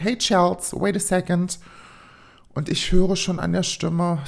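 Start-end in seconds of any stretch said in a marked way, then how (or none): none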